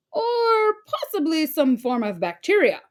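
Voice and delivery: a high, whiny expert voice; fussy, worried expert; Mister Mellon's voice. A narrator's reading. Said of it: "said Mister Mellon".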